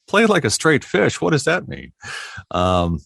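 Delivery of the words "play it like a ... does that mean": high, baffled echo of the request; incredulous. Retold or imitated. Retelling the fish job to the interviewer, high disbelieving echo. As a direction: repeat it back in total disbelief. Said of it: "I'm like".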